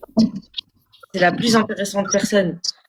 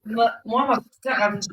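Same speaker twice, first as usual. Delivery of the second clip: normal talking voice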